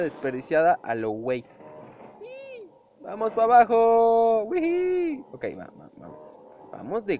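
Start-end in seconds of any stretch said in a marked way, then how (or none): none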